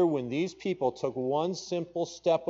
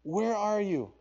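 higher, searching where are you; lightly voiced God calling; 9 semitones higher, slower than usual. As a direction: call gently as God looking. Voicing God calling Adam.

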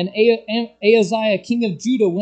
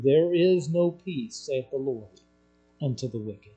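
grave hush; 5 semitones lower, 6 dB quieter than usual. Retold or imitated. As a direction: let the Lord's words sink, hushed. Quoting the Lord solemnly.

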